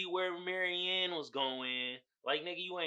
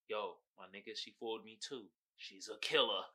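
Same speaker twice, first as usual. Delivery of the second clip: deep mock buddy voice; 7 semitones lower, 9 dB quieter than usual. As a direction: lower and softer, a low bro confiding. Voicing Joe answering.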